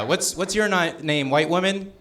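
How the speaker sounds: ordinary talk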